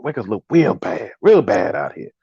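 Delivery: low, slow, softened comedian impression; John Witherspoon. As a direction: drop the voice and drag it out like the comedian. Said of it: "in my John Witherspoon voice".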